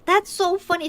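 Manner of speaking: high, loud burst of delight; re-enacted delighted surprise; retold amused exclamation. Retells amused reaction to funny word.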